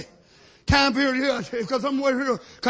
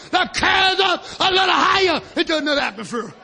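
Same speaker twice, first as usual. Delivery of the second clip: full-force preaching; 8 semitones higher, 4 dB louder than usual. Acted. Preacher quoting Brother Brandon to his church, shouting. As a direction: proclaim it with everything you have.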